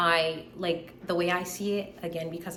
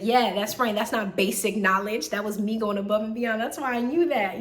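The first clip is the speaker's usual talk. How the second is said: cocky retort; 4 semitones higher, 7 dB louder than usual. Retold or imitated. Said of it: "I'm like".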